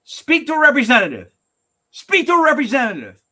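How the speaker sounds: loud, high exasperated yell at an automated menu; high, furious shouting into the phone; screaming. Retold or imitated. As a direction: scream it like a mad person. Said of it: "I end up screaming".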